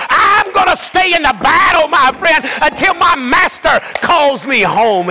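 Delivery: rising fervent pulpit cry; climactic preaching. Acted.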